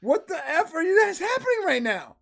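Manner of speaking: high, loud mock outburst; acted-out incredulous yell; exasperated disbelief. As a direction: burst out baffled, pitch shooting up. Acting out baffled reaction to neighbor.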